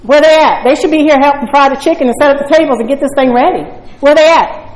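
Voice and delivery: imitating my old impatient self; indignant complaining. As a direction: gripe at the empty room, half-mocking yourself. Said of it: "and I'd say".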